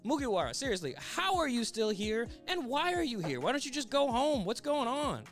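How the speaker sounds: high, pleading disbelief; hammed-up villain at wit's end; acted exasperated panic. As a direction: fire off bewildered questions in a high voice. Rattled villain questions returning enemy.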